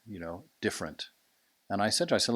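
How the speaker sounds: ordinary talk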